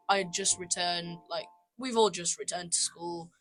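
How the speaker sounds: normal talking voice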